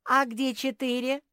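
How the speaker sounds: high, loud, rising question; amused mocking. A narrator's reading. Squirrel mocking the bear's counting, amused teasing. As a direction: tease loudly with a lifted voice. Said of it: "засмеялся помощник Софи".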